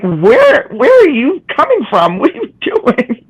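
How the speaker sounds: high baffled questions; baffled friends. Retold or imitated. Friends reacting in surprise to her new southern y'all. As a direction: sound puzzled and thrown. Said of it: "They're like".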